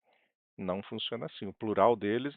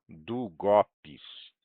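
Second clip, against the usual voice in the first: "Długopis" stretched out, middle syllable GO hit loud; stress on GO; 2 semitones lower, 5 dB louder, much slower than usual. Shown as a demonstration. Teacher reminding learners of intonation, stress on go in "Długopis". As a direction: say "Długopis" slowly, leaning hard on go. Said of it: "Lembrando sempre da entonação".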